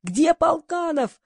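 high, drawn-out straining call; stretched-out, high-pitched narrated yell; straining holler. A narrator's reading. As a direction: holler it high and slow without full volume. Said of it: "надрывался Григорий".

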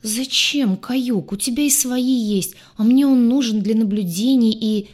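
high, loud, aggrieved argument; upset protest. A narrator's reading. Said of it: "расстроенно буркнул Урак".

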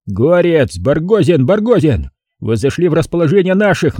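loud, high radio call-out; crackly military radio. Retold or imitated. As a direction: call urgently over a field radio. Radio call under fire.

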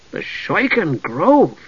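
slow, wondering murmur; musing to himself. A narrator's reading. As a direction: savor the name slowly. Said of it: "Tass said to himself".

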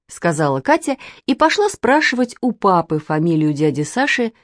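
ordinary delivery, nothing marked

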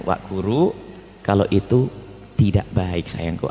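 low, tender admonishing; gentle reminder. Acted. Parent gently telling the child it is not good, tenderly. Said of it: "mulailah dengan kelembutan".

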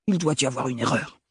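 débit rapide, vieil homme contrarié; il refuse d'y croire, vite; vieil oncle incrédule. A narrator's reading. Le grand-oncle réagit. (grand-oncle qui proteste, rapide)

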